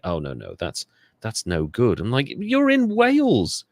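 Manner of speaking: normal talking voice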